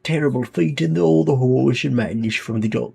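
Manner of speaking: speechify like a British leader on tape; British accent. Acted. Leader welcoming citizens to Un London, in a light British accent. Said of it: "I have to do the accent".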